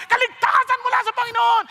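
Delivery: high, forceful preacher's shout; loud exultant proclamation; shouted praise. Acted. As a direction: cry it out as a whole crowd would. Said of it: "Sumisigaw lahat".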